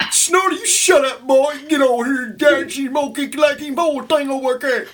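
high, loud mock holler at a son; yelling country dad. Imitating an uncle hollering at his son Snorty. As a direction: bark at the boy like a rural dad. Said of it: "his dad ... called Charles Junior".

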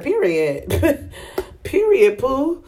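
giggling through a playful period; laughing it off. Real, not acted. Vlogger tells viewers her rule, laughing. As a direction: laugh as you seal the point.

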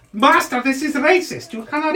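high, protesting gnome servant; gnomey voice. Acted. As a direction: object to your master as a little gnome. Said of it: "your little gnomey voice".

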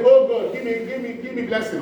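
sudden loud cry of "Oh God"; hammed-up begging prayer; loud mock prayer. Shown as a demonstration. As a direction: call out to God like a beggar. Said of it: "We say".

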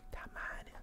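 whispered curse under the breath; whispered curse, almost no voice. Real, not acted. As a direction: hiss it so nobody hears.

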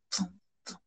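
hushed echo fading away; very quiet echoing aftersound. A narrator's reading. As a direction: repeat it softly like a dying echo. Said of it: "the word echoes wickedly".

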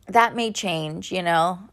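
ordinary delivery

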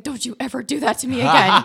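loud, indignant retold outburst; acted scolding. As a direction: scold your boyfriend loudly, half laughing.

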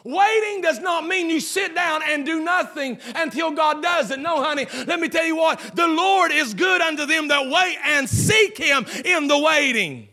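high, loud preacher's shout building through the line; full-force preaching. Acted. Pastor shouting to the congregation, full-force preaching. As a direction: preach it out to the whole church.

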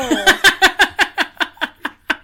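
loud high burst of laughter. Real, not acted. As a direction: crack up laughing. Laughing at dark joke.

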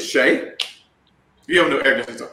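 imitating a goofy friend's voice; Eric's goofy voice. Speaker imitates his sales mentor advising him, goofy. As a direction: do a light, goofy take on a mentor. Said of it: "That's how he talks, kinda, kinda goofy".

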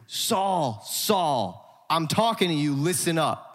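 stern, attention-grabbing call; commanding, drawn-out name, then a pointed order; emphatic, scary summons. Acted. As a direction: call him out sharply and demand his attention. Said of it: "That was a little scary".

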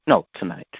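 ordinary talk, nothing marked

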